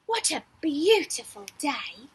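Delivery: ordinary delivery, nothing marked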